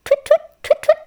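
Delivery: very high, loud twittering; chittering starling. Acted. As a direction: chirp it like a starling. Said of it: "The starlings chittered".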